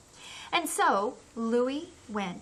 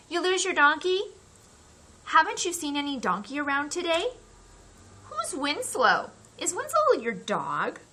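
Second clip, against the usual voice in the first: neighbors asking; 7 semitones higher, 4 dB louder than usual. Acted. Neighbors calling out questions.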